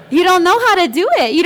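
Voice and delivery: real laugh breaking into the line. Real, not acted. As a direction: laugh it out in disbelief. Laughs at the awkwardness.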